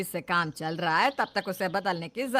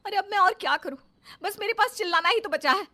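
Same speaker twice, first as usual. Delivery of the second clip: exasperated outburst; 8 semitones higher, 5 dB louder than usual. Acted. Fed-up wife answers family.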